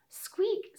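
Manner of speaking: very high tiny squeak; a quick high mouse squeak. Acted. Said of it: "said the little mouse".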